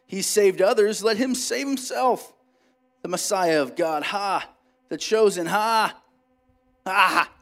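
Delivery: taunting ringleaders. A narrator's reading. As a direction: sneer it at the man on the cross.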